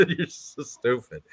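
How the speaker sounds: slow words through real laughter; laughing. Real, not acted. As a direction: crack up while teasing. Laughs at a trolling chatter.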